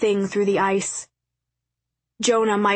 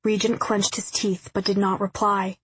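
ordinary delivery, nothing marked

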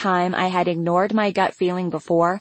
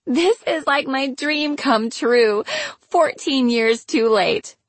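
high, slow, drawn-out delight; pitched-up dreamy glee; delighted gushing; 6 semitones higher, slower than usual. A narrator's reading. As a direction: stretch the words out with gleeful relish. Karina gloating over the news.

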